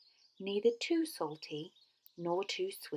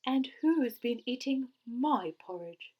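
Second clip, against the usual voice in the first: higher, louder cross voice; cross Mother Bear; 5 semitones higher, 6 dB louder than usual. A narrator's reading. Mother Bear asking who ate her porridge, cross. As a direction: demand it crossly, voice raised. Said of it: "in her cross voice".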